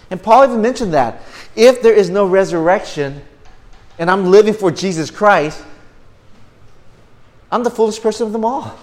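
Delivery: high, loud pulpit cry; impassioned preaching. Real, not acted. Preacher cites Paul on living without resurrection, impassioned preaching. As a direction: ring it out loudly over the congregation.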